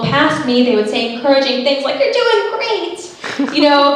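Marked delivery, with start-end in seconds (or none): 2.0-3.5 very slow, high sugary encouragement; patronising encouragement. Retold or imitated. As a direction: coo it like to a child.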